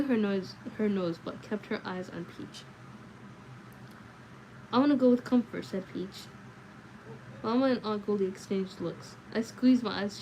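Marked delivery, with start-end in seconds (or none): 4.7-5.6 put-on insistent child. Peach repeats his wish loudly.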